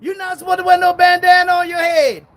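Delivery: shouted mock rebuke. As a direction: yell it like a fussy preacher. Mocking scolding preacher.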